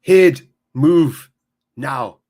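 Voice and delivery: loud, barked mock-Scottish command; punchy comic Scottish accent, word by word; Michael Myers's Scottish. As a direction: bark it in a hammed-up Scottish accent. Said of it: "sounding like Michael Myers is Scottish".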